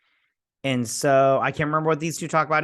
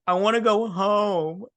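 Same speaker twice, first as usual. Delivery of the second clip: higher, lightly put-on whine of a crier; mock crying; 6 semitones higher, 4 dB louder than usual. Retelling where she starts crying, mock tearful. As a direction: imitate a tearful character whining. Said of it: "this is where she starts crying".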